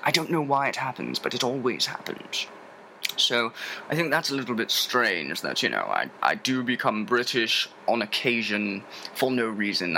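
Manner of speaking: playful put-on British accent. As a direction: slip suddenly into a British accent. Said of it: "all of a sudden I turn British".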